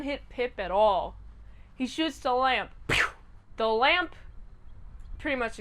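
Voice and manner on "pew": one quick "pew" noise; imitated gunshot. Rocky shoots the lamp, mimicked gunfire noise. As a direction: mimic a single bullet.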